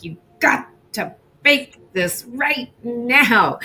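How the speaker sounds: imitating a nagging boss in her head; mock pushy inner voice. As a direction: lean on each word like a taskmaster. Voicing inner pressure.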